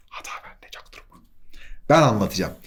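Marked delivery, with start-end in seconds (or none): 0.1-1.2 barely audible whisper; whispered aside. Real, not acted. Teacher covertly owning up to a mistake, whispered. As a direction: whisper it like a secret admission.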